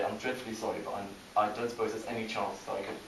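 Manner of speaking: soft, roundabout, over-courteous request; polite British apology. Acted. Briton asking to get round someone, meekly polite. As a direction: apologize quietly and tiptoe into the favour. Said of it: "you might have noticed I'm British".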